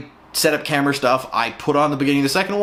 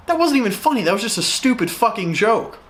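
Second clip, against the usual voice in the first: exasperated; 6 semitones higher than usual. Real, not acted. Exasperated rant about bad joke.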